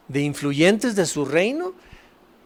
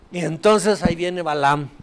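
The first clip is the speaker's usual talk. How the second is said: usual speaking voice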